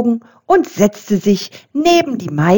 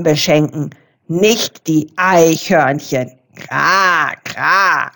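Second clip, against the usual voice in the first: lower, croaky raven lecturing; bossy raven, voiced lower; know-it-all raven; 4 semitones lower, slower than usual. A narrator's reading. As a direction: croak it out, correcting the squirrel. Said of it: "unterbrach Rabe Krax".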